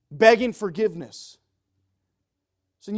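swelling pulpit shout; impassioned preaching. Real, not acted. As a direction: cry it out with urgency. Preacher acts up the sinner's pleading.

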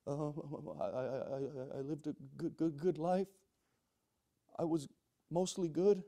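acted timid sinner answering the judge; nervous stammer. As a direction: stammer haltingly, ending on an unsure rise. Sinner nervously answers God's question.

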